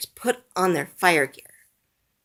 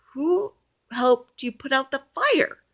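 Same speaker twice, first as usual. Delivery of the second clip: higher, puzzled Bob; Bob's voice; 9 semitones higher than usual. A narrator's reading. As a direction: ask like a curious young man. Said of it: "asks Bob".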